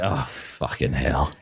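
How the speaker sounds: low, slow mock despair; exasperated groan. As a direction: sink into a drawn-out, softened curse. Acting out weary reaction to her.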